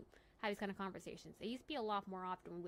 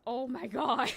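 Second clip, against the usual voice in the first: much louder and slow, drawn out in disbelief; loud exasperated oh my God; 3 semitones higher, 13 dB louder, much slower than usual. Real, not acted. Telling chat about customers venting, a loud slow oh my God. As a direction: groan it out, overwhelmed by what people unload.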